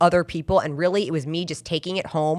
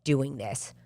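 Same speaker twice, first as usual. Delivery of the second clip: softer, low resolve to herself; quiet self-talk; 5 semitones lower, 7 dB quieter, slower than usual. Retold or imitated. Sitting in the parking lot talking herself out of it, softer. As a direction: murmur the decision to yourself. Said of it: "be like".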